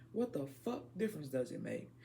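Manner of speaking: ordinary delivery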